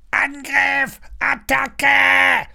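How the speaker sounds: high, loud croaking battle cry; shrill-pitched parrot attack call, last vowel held; excited parrot squawk. A narrator's reading. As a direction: screech it like a parrot going to war. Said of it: "krächzt der Papagei aufgeregt".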